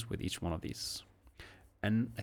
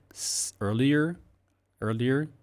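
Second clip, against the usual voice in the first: "earlier" lifted high and a bit louder; rising earlier; 4 semitones higher, 4 dB louder than usual. Shown as a demonstration. Teacher isolates "earlier" with a rising tune. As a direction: push "earlier" up with a rise.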